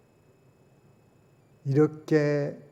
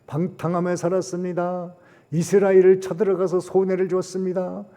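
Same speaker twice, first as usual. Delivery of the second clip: a hint of confessing people, louder and higher; lightly voiced Ninevites confessing to God, higher; repentant Ninevites; 5 semitones higher, 6 dB louder than usual. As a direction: confess their sins aloud to God. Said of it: "그들의 죄를 회개합니다".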